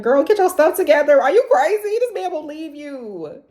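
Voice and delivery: high, loud, exasperated telling-off; sassy self-scold; scolding herself. Retold or imitated. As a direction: snap at yourself like a big sister. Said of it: "but also, like".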